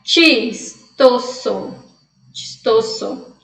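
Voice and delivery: CHISTOSO said very slowly "tchis-to-so"; Spanish CH. Shown as a demonstration. Teacher repeating the word for students, Spanish CH. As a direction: stretch Chistoso out syllable by syllable with "tch". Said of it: "Coloquei pra vocês aqui o som".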